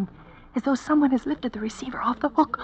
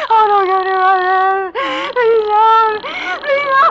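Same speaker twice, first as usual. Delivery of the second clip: loud, higher, crying begging; terrified screaming plea; 7 semitones higher, 6 dB louder, slower than usual. Acted. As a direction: scream for mercy. Victim attacked, begging.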